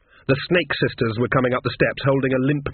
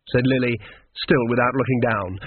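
ordinary delivery, nothing marked

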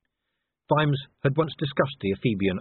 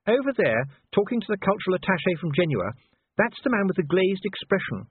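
high-pitched wife pointing someone out; genteel wife; 10 semitones higher than usual. A narrator's reading. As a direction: voice the wife well above narration.

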